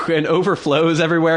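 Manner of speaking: ordinary talk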